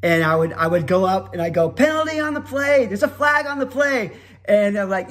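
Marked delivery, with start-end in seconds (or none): 1.7-4.1 high-pitched play-by-play voice; announcer's penalty call. Retold or imitated. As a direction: announce the flag to the stadium.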